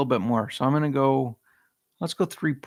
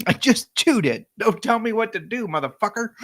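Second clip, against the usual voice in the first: higher, louder mock-defiant snap; defiant retort; 9 semitones higher, 3 dB louder than usual. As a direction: throw it back like a touchy comeback. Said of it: "That's what that came across like".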